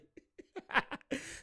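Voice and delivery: short, high giggle; laugh. Real, not acted. Reactor apologising for his bad impression, giggling laugh. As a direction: crack up softly after a failed impression.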